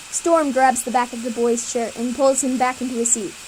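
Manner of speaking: ordinary delivery, nothing marked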